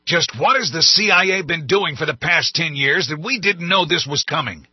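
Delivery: high, loud furious roar. A narrator's reading. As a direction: roar at the cabinet. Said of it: "roared at his hastily assembled cabinet".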